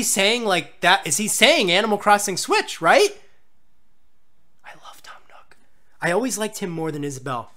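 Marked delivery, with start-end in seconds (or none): 4.6-5.6 hushed, voiceless confession of love; adoring whisper. Real, not acted. Adoring aside after the reveal.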